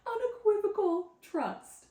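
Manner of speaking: unequivocal trust said high, loud and slow in a laugh. Real, not acted. Reading the word unequivocal, laughing. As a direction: unequivocal stretched out through laughter. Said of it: "they're saying, like".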